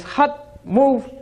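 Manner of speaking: high sharp call of hut, move; commanding the donkey. Acted. Washerman ordering the donkey off, a high call. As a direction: shoo the donkey along with a call.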